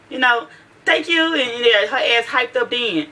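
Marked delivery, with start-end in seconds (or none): 0.8-1.3 high-pitched imitated thanks; perky reply. Daughter thanking people who compliment her, piping up. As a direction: pipe up clearly, imitating the girl.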